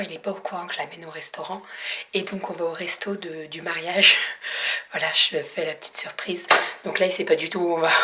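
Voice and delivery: hushed secret voice; whispering while hiding. Real, not acted. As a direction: keep it hushed, he might hear. Hiding surprise from husband.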